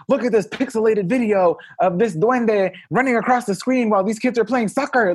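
high, hyped tabloid-TV announcer; TV sensational host. Retold or imitated. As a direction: sell the footage like a scoop. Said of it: "But they would be like".

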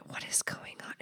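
hushed, amazed whisper; mock whispered astonishment; whispered disbelief. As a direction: whisper it like you can't believe it. Retelling her disbelief at husband.

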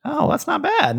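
usual speaking voice

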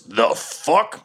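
lightly voiced, baffled half-dragon; half-dragon man. Game master as the dragon man at the helm, baffled. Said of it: "half-dragon man with only one arm who says".

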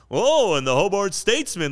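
over-exaggerated broadcaster, high and loud; hammed-up play-by-play parody; goofy announcer voice. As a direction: boom it out as a mock sports commentator. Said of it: "I put on this goofy voice".

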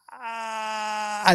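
long strained "I" held high; drawn-out stammer. Real, not acted. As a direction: stall on one stretched, exasperated syllable. Stalls, exasperated, before confessing.

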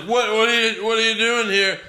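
a hint of a flustered ref; mock ref. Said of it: "and he's like".

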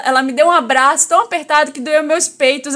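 usual speaking voice

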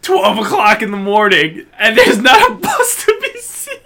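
high, loud frantic complaint; panicked friend. Retold or imitated. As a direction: whine it desperately. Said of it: "you called me like".

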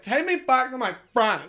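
high whiny demand; drunk girl voice. Retold or imitated. Drunk passenger demands a ride, high and whiny. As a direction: whine it like a drunk customer. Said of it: "She's going, like".

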